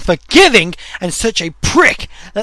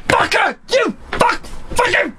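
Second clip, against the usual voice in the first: high-pitched, strained rage rant; losing gamer's shrieky tirade; pissed off; 8 semitones higher than usual. Real, not acted. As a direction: spit curses in real, worked-up fury. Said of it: "I'm getting fucking pissed off!".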